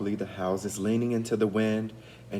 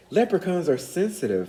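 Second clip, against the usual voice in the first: observing dryly; 8 semitones higher, 4 dB louder than usual. A narrator's reading. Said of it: "Finn observed".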